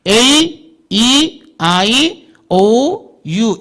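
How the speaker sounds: A, E, I, O, U stretched one at a time; teacher sounding long A, E, I, O, U with pauses; long vowels. Shown as a demonstration. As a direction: draw out A, E, I, O, U as long English vowels. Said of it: "길게 읽기에는".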